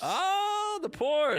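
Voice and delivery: high-pitched squealed oh. Real, not acted. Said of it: "Oh, you gotta open".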